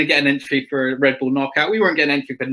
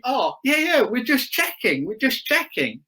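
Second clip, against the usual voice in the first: lightly voiced evasive mechanic, higher; mock Yamaha bloke; 9 semitones higher than usual. Rider imitating the Yamaha mechanic's cagey reply, lightly voiced higher. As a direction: brush him off like a caught-out mechanic.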